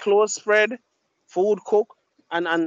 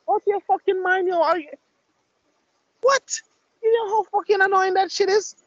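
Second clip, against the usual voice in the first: high-pitched exasperated rant; incredulous, high-pitched mock outrage; annoyed outburst; 11 semitones higher than usual. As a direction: go off at an unwanted caller, pitch way up. Acted outburst at unwanted caller.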